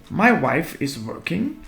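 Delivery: normal talking voice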